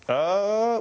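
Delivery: doubtful hesitation, pitched high; unsure Murray uh. Retold or imitated. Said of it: "when he's really not sure".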